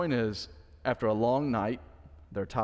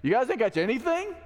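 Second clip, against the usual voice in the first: jeering; 9 semitones higher than usual. Retold or imitated. Stranger on the beach mocking the tired fishermen, jeering. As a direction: tease them from the shore. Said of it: "who's now jeering you".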